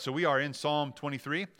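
ordinary delivery

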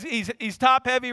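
ordinary talk

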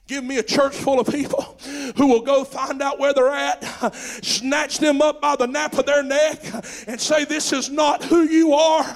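loud, high, driving preaching; preacher urging the church to rescue sinners, shouting; preaching at full force. Real, not acted. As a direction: build the congregation up.

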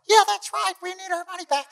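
high-pitched women backing him up; squeaky chorus of two ladies; ladies' voices. Retold or imitated. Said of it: "the two ladies are behind them going".

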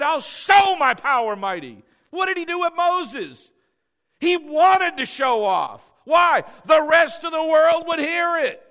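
high, loud exhorting shout; full-force preaching. Real, not acted. Pastor urging the church to be bold, shouted preaching. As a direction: drive it home at the top of the voice.

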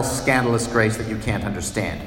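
usual speaking voice

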